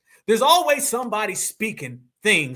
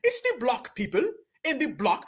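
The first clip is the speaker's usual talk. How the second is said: higher-pitched imitation of British speech; teasing British accent, pitched up; mock British accent; 5 semitones higher, 3 dB quieter than usual. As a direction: mimic Brits with an exaggerated British "block".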